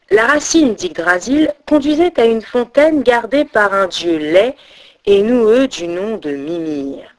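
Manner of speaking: ordinary delivery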